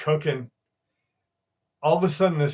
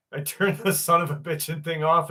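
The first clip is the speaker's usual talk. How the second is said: normal talking voice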